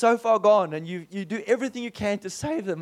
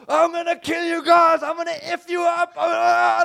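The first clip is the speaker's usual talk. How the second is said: high mock yell of a raging man; angry man retold to a friend, high mock rage; imitating enraged Rob; 11 semitones higher, 7 dB louder than usual. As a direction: shout the threat in a shrill raging voice. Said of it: "Rob is like this".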